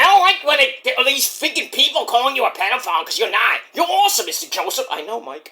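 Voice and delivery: ordinary talk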